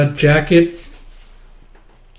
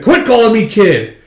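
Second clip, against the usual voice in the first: loud, higher irritated retort; annoyed snap; 8 semitones higher, 6 dB louder than usual. Retold or imitated. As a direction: bite back, fed up with the nickname. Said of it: "He's like".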